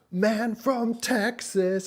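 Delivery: high, drawn-out imitation shout; hammed-up excited holler; hyped-up owner. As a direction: imitate an overexcited man hailing someone, very slowly. Owner grabbing him, retold.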